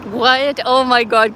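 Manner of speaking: loud surprised gasp of words. Real, not acted. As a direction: exclaim in sudden disbelief. Reading a surprising viewer comment.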